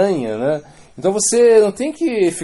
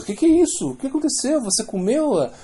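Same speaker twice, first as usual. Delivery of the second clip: much higher, startled mother's questions; a mother catching her son, pitched way up in alarm; shocked mother; 11 semitones higher than usual. Retold or imitated. Mother confronting her son over the eaten offering, high and startled.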